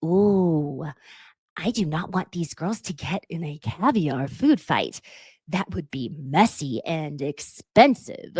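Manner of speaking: slow, put-on breathy movie-star voice; Jennifer Tilly impression. In confessional, mimicking Jennifer Tilly's slow starlet purr. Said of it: "Jennifer Tilly goes".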